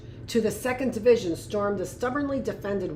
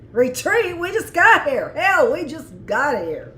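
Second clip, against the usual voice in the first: higher, louder tough-hero delivery; heroic soldier; 6 semitones higher, 6 dB louder than usual. Acted. Admirer replaying the tall soldier's line, heroic. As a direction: savour it like a movie hero. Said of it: "something a hero would say".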